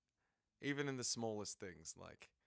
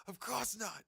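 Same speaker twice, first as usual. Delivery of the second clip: high indignant refusal; 11 semitones higher than usual. Acted. Voicing Candel's flat refusal.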